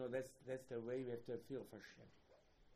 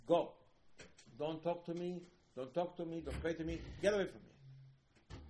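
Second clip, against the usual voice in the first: louder, higher commands, last one slow; angry dismissal; 5 semitones higher, 7 dB louder than usual. Acted. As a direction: cast him out, hammering each order. God voiced rejecting the sinner.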